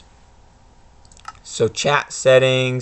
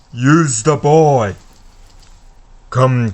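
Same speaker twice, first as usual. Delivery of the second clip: slow, louder hissing command; a dark lord giving an order; Voldemort voice; 4 dB louder, slower than usual. Acted. Voldemort ordering his servant to use Harry, slow menace.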